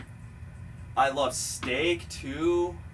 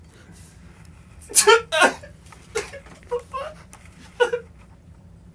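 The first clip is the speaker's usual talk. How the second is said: high laugh; 6 dB louder than usual. Real, not acted. Laughs after the poem.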